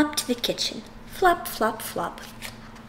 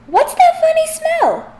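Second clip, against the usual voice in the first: puzzled dad; 6 dB louder than usual. Acted. Dad smells burning breakfast.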